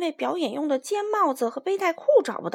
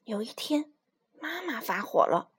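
ordinary delivery

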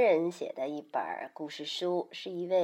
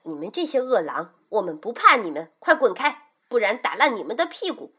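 angry threat; 2 semitones higher, 5 dB louder than usual. Acted. Mother threatens the wolves, angrily. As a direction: drive them off with a fierce telling-off. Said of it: "她怒气冲冲地站在门边儿".

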